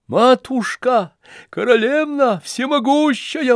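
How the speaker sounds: very high, loud begging wail; wailing plea. Acted. Lebedev wailing to Nastasya Filippovna on his knees. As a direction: grovel and howl for mercy. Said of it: "вопил Лебедев".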